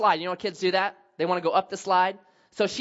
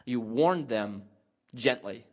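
normal talking voice

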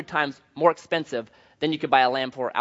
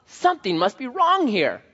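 much higher, amazed musing; surprised inner thought; 7 semitones higher than usual. Retold or imitated. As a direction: act out being pleasantly baffled by him. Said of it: "I'm thinking".

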